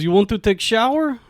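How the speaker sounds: put-on Russian question; thick Russian accent. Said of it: "she has, like, a thick Russian accent".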